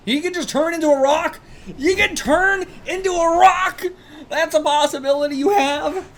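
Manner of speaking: high, loud, disbelieving excitement; high-pitched excited exclaiming; amazed outburst. Real, not acted. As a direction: shout it out, astonished at the discovery. Amazed reaction to a manga power.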